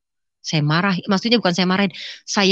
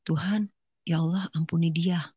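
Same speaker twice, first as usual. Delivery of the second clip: soft, humble plea to God; quiet prayer; 6 dB quieter than usual. Retold or imitated. Daughter praying forgiveness for her mother, quiet prayer. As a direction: pray softly, almost to yourself. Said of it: "Saya tetap berdoa. Saya cuma bilang".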